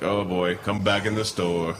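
low, slow, lightly voiced store guard; a hint of a heavy-handed mall cop; mock stern guard. Mall guard catching a boy stealing, lightly voiced low and slow. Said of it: "You get that guy".

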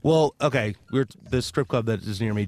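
ordinary delivery

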